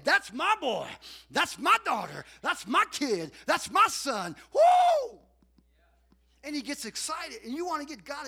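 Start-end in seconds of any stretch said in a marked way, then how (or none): 0.0-4.4 much higher and louder cheering from the stands; God cheering from the bleachers; proud shouting fan. Retold or imitated. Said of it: "he's in the bleachers saying". 4.5-5.2 loud whoop. Retold or imitated. Cheering fan in bleachers.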